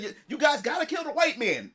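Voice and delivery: high-pitched mock protest; playful trash talk. [name] having fun with the group, high mock protest. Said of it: "we just talking trash, we having fun".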